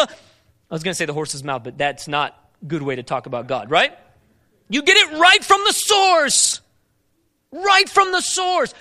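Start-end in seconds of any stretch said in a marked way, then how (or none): 3.7-8.7 loud, high, slow shout; fervent preaching shout. Acted. Preacher crying out to the congregation, slow fervent shout. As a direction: hammer the point, slowly.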